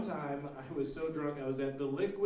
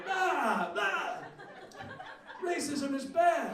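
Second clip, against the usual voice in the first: crazy street preacher; 11 semitones higher, much slower than usual. Retold or imitated. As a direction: ham up a raving street guy yelling into a pigeon. Crazy guy screaming with pigeon.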